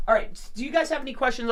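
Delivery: fast, eager rush of words; talking quickly and brightly; rapid nonstop chatter. Real, not acted. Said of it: "I'm yapping".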